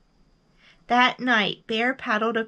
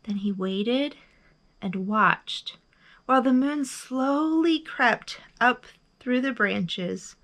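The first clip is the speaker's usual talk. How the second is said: plain reading voice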